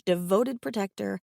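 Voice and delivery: ordinary delivery, nothing marked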